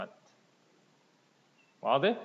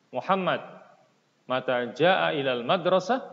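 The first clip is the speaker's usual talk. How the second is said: model dialogue reading; 4 semitones higher, 5 dB louder than usual. Shown as a demonstration. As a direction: clear projected reading, questions lifted at the end. Reads dialogue aloud to class.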